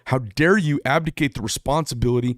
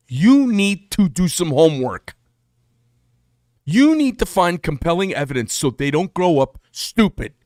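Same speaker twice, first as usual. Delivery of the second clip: louder, higher, pushing hard; raised ranting voice; 5 semitones higher, 5 dB louder than usual. Real, not acted. Ranting at parents who let kids grow up stupid, raised voice. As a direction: lecture the viewers like a fed-up uncle.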